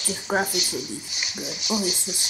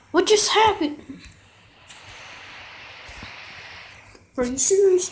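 shocked disbelief; 10 semitones higher than usual. Real, not acted. Screen froze mid game.

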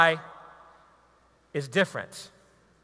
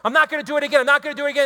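higher, insistent promise to himself; higher, vowing voice; mock resolve; 5 semitones higher, 3 dB louder, much faster than usual. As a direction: swear off it, half believing. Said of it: "And then I look".

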